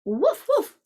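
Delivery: short woofs jumping up in pitch; dog's bark. A narrator's reading. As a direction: bark like a storybook dog. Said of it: "barked the dog".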